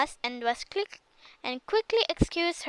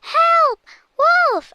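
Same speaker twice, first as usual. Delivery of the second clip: much higher, much louder desperate cry; shouting for help; 10 dB louder than usual. A narrator's reading. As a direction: scream for help at the top of her lungs.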